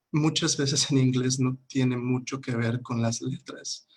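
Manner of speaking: usual speaking voice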